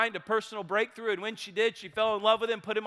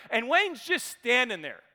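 ordinary talk

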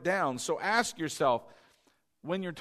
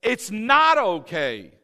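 It's NOT okay, slow and loud; emphatic warning; 6 semitones higher, 11 dB louder, much slower than usual. Real, not acted. Preacher warning the congregation, loud and slow. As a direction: hammer the word not. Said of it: "Did you hear what I said".

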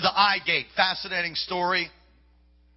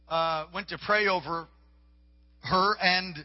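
ordinary talk

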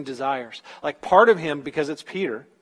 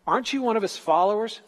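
higher-pitched, lightly acted accusing question; questioning accuser; 6 semitones higher, faster than usual. Accuser asking Peter about Jesus, mildly pressing. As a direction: press him, voice lifting to the end.

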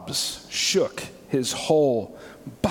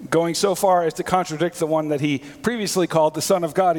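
usual speaking voice

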